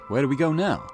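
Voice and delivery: ordinary talk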